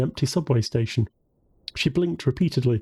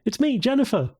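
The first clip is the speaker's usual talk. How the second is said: high-pitched, eager announcement; excited; 11 semitones higher than usual. A narrator's reading. Woman identifies herself on the phone, excitedly. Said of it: "her face lit up with excitement".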